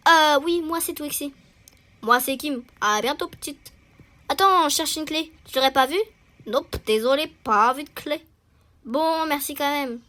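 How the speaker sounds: loud, lively read of the schoolgirls' dialogue; a hint of animated characters, pushed loud; voicing game characters. Schoolgirls asking a new student about a lost key, loud and lively.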